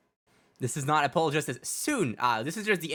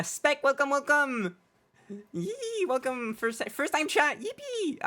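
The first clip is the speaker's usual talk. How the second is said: high squeaky voice changer voice; 13 semitones higher, slower than usual. Acted. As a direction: chirp it through a pitched-up changer. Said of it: "funny voice with my voice changer".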